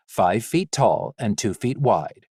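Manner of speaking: plain reading voice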